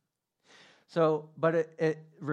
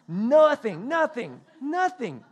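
high, loud "nothing" three times over; exasperated repetition; 9 semitones higher, 9 dB louder, slower than usual. Retold or imitated. Man finds no answer after searching, high exasperated outburst. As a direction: throw up each word in mock exasperation.

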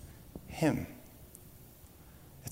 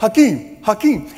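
loud call, pitched higher; dad calling out; 8 semitones higher, 8 dB louder than usual. Retold or imitated. Dad calling the star by name, loud call. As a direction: call him like a man in the crowd. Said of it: "he's like".

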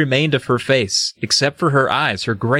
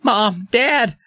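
higher mock cry for his parents; a hammed-up panicked call; mock scream; 10 semitones higher than usual. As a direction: call out like a scared kid. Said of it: "I tried to scream".

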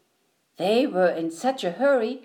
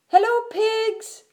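high, stretched hello; Duck calling out; 12 semitones higher than usual. A narrator's reading. Duck greets the pigs while riding, calling out high.

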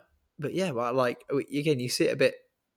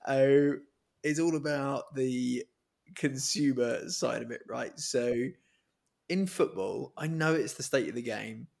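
normal talking voice

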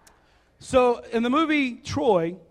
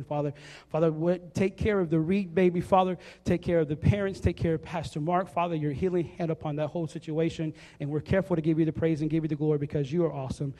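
opening prayer; 4 semitones lower, faster than usual. Acted. Pastor leads an opening prayer.

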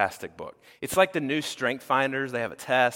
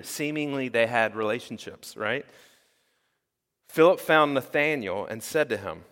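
ordinary talk, nothing marked

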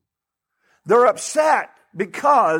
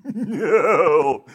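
laughing no; 3 semitones lower than usual. Real, not acted.

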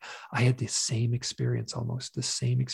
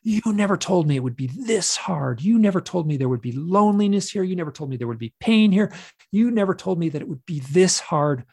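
raised, loud, anguished protest; anguished accusation; 5 semitones higher, 6 dB louder than usual. Retold or imitated. Hypnosis client re-enacting accusing the beings, anguished and loud. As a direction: hurl the accusation, hitting each repeat hard. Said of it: "I'm pointing to these beings and I'm going".

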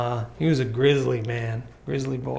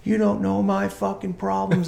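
higher-pitched mock outburst; retold screaming rant; 5 semitones higher than usual. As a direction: imitate an upset kid's rant without real volume. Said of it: "He screamed up and down".

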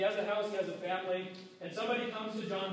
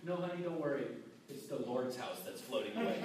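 mock calm reassurance; 7 semitones lower, 5 dB quieter than usual. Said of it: "I didn't go like this".

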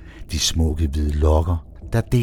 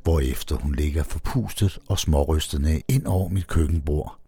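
ordinary delivery

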